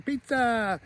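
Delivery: high, softened mock kid cheer; Kevin. Kevin storms downstairs after pizza, high mock holler. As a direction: imitate an excited little boy's holler without the volume. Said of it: "Kevin comes charging in and saying".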